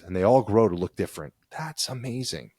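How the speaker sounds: ordinary delivery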